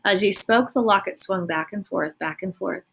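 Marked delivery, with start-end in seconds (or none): none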